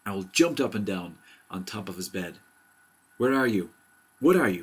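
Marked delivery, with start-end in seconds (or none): 3.2-4.6 loud, higher-pitched alarmed questions; crying out. A narrator's reading. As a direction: demand answers from the bumps, voice raised. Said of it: "he cried".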